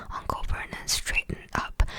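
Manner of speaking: ordinary delivery, nothing marked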